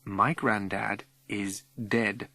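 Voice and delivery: slow and deliberate, letting it sink in; slow deliberate telling; slowly. Acted. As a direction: spell it out gently, word by word. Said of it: "I speak slowly".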